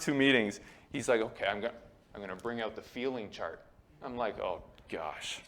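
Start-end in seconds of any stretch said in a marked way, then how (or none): 1.2-3.6 low, hushed counselor impression; soft, low put-on therapist; mock counselor. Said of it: "He didn't talk like this". 4.4-5.4 low, soft mock dismay; deflated, under the breath; muttered dismay. Retold dread at feeling chart.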